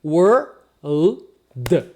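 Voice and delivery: "were", "l", "d" as separate sounds; spaced-out phonetic pieces "were, l, d". Shown as a demonstration. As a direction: break the word into "were, l, d" with pauses. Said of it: "Se você falar só esse pedacinho".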